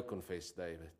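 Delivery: ordinary talk, nothing marked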